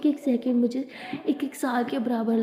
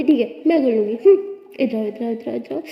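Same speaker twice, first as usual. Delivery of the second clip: playful grab; 6 dB louder than usual. Acted. Abira playfully grabbing.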